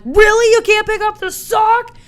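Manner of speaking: high, loud incredulous scolding; exasperated. Retold or imitated. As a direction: scold the sock in disbelief. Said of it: "I was like".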